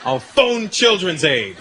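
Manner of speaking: slow, deliberate warning; a hint of a brave kid's threat. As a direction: spell out the bluff slowly. Kid threatening dad.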